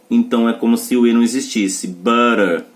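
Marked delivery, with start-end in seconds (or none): 1.9-2.6 "Butter" said as ba-rer, the U an a and the tt tapped. Shown as a demonstration. Teacher explaining the silent E, "Butter" with a. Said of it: "o E não tá na sílaba do U".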